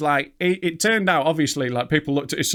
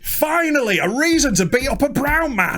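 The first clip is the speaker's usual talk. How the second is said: mock thug voice; 9 semitones higher than usual. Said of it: "voicing the Millwall fan's thoughts".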